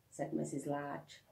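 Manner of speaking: ordinary delivery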